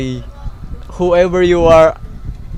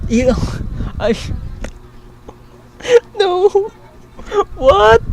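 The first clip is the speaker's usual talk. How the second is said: crying laugh; 3 dB louder than usual. Real, not acted. Overwhelmed by the donation.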